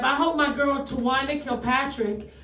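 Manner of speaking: usual speaking voice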